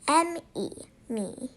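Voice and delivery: plain reading voice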